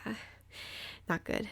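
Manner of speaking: soft, defeated answer; quiet, crushed not good; dejected murmur. Retold or imitated. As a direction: mumble it, beaten. Dejected reply after bad run.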